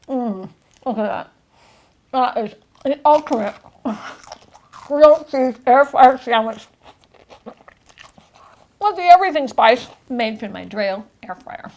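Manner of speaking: talking through a mouthful; mouth full. Real, not acted. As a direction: speak around a bite of sandwich. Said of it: "I should not be talking with my mouth full".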